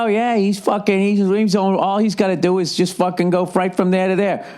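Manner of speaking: high, whiny voice; mock envious runner. Imitating a jealous runner griping about his rival, high whine. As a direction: whine about the other guy's easy start. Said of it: "you always think that other guy".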